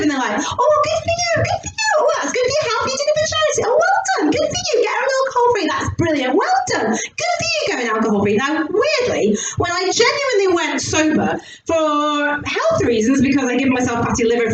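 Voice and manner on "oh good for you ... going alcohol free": very high-pitched mock encouragement; gushingly supportive. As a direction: coo congratulations like over-supportive friends. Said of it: "everyone's really supportive".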